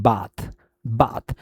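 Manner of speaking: बाथ with a long "aah" vowel; long open A. Shown as a demonstration. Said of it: "ए sound होता है long and open". (बाथ में लंबा खुला आ)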